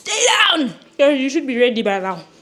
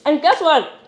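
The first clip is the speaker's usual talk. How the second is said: ordinary delivery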